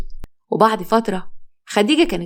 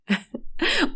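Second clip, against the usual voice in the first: short, soft, high-pitched laugh; light laugh; 11 semitones higher, 7 dB quieter than usual. Acted. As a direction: let out a small rising giggle. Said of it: "الاتنين فضلوا يضحكوا مع بعض". (ضحكة قصيرة خفيفة)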